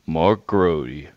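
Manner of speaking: a lightly voiced low, laid-back rock star reading a name; low, unhurried drawl of a rock singer; hint of a cool rock star.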